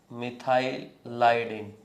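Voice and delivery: मिथाइलाइडिन drawn out slowly in two parts; slow, split repeat. Shown as a demonstration. Teacher repeats the new term after asking what was said, slowly split. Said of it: "क्या बोला?".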